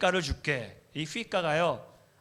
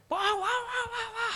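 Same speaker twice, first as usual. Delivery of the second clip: 아주 높고 느리게 길게 뽑는 아이들 함성; 신나서 소리 지르는 꼬마들처럼 와 와; 떠드는 아이들 흉내; much slower than usual. Retold or imitated. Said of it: "하고 뭐 깔깔깔깔거리고". (교회 입구에서 떠드는 아이들을 흉내 내며 높게 외치는 와)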